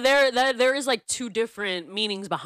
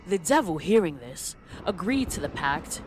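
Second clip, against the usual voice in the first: put-on theatrical storyteller in a loose British accent. Telling the Jack-o'-lantern legend, theatrical loose British accent. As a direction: tell it grandly in a British accent, pausing between phrases. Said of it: "I did, like, a loose accent".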